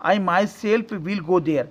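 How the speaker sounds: each word drawn out slowly; slow dictation. Shown as a demonstration. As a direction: stress on "myself", spoken slowly. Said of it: "जोर दे रहा है". ("myself" पर जोर देकर धीरे-धीरे)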